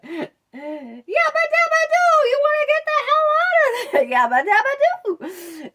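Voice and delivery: shrieking yabba dabba doo with laughter; Flintstones cheer. Retold or imitated. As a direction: belt it like Fred Flintstone leaving the quarry. Flintstones quote, cheering to leave work.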